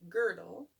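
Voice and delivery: usual speaking voice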